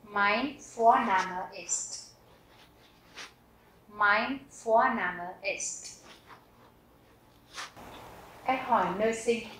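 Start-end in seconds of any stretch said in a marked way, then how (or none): 0.0-6.0 Mein Vorname ist stretched out, each word apart; very slow model answer. Shown as a demonstration. Teacher drilling the answer very slowly for learners. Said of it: "teacher models answer slowly".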